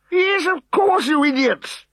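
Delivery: high, loud irritable snap; high loud exasperated snap; snapped. A narrator's reading. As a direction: snap at the idiots. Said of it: "snapped the irascible chieftain".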